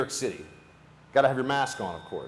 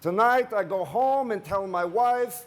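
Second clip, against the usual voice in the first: put-on higher, slow Slavic accent, proud and delighted; 9 semitones higher, slower than usual. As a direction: beam it out in a Slavic accent, slowly. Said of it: "He's got kind of a Slavic accent".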